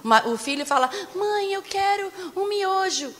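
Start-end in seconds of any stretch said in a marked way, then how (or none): 1.1-3.1 put-on high, drawn-out pleading kid voice; whiny child imitation. Preacher imitates son asking mother for noodles, whiny child. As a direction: stretch it out like a begging child.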